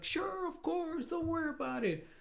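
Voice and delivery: imitating an obliging official, very high and drawn out; high, slow, airy mock reassurance; breezy reassurance. Police head brushing off an apology, breezy reassurance.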